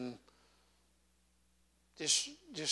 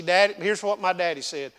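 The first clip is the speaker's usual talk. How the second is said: ordinary talk, nothing marked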